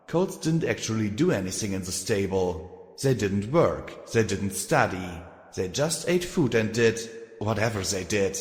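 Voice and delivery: usual speaking voice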